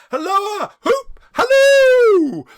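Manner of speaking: very high, loud shouting whoops; gleeful whoops. Acted. Old Scrooge whooping at his childhood memories. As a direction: holler with wild joy.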